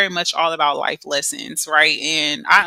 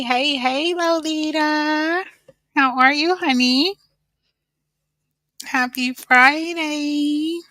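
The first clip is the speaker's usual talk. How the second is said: ordinary talk, nothing marked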